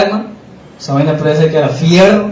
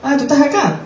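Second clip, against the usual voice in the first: much higher, sing-song taunt; mocking high quote; 11 semitones higher than usual. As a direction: mimic the attackers' teasing tone. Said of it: "Me dicen así".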